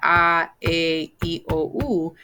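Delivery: A, E, I, O, U as ah, eh, ee, oh, oo; "A, E, I, O, U" recited with Spanish sounds; Spanish vowels. Shown as a demonstration. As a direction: model each pure Spanish vowel in A, E, I, O, U. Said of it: "pronouncing the vowels just like that".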